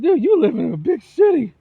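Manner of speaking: loud, high incredulous call-out; retold shout. Ranting at a clown from California, loud and incredulous. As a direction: shout it at him in disbelief. Retold rant at city guy.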